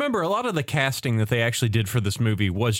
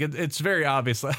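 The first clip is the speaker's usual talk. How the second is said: ordinary talk, nothing marked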